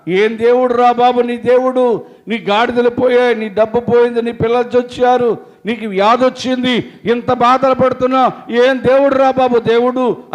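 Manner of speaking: loud, high jeering scoff; mocking taunt. Job's friends jeering at Job, loud mocking. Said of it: "అని చెప్పి హేళనగా మాట్లాడుతున్నారంట".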